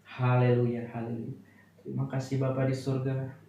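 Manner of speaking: earnest prayer. Real, not acted. Leads closing prayer.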